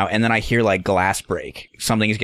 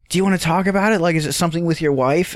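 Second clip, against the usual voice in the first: high-pitched, well-meaning question; imitated sympathetic prying; 7 semitones higher than usual. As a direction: mimic someone coaxing him to open up. Voices Lenore probing the man.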